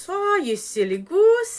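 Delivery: high, loud, playful scolding; chiding old woman. Acted. Old woman teases Ivan for struggling alone, playfully chiding. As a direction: exclaim it like a fond granny.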